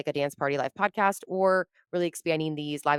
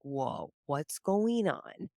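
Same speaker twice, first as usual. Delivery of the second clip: dazed wonder; 5 dB quieter, much slower than usual. Retold or imitated. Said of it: "I'm like".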